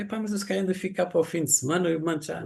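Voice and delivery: high, quick, keen to send it now; eager insisting. Retold or imitated. Insisting to his wife he will send it now, eager and high.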